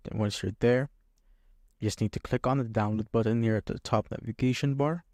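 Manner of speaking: ordinary talk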